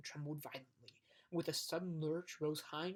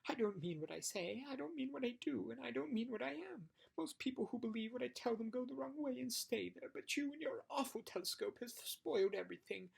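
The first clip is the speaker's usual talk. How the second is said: higher, whiny voice of a small sulking demon; higher, self-pitying little demon voice; whimpering little demon; 8 semitones higher than usual. Acted. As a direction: whine it like a caught little imp. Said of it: "began to whimper".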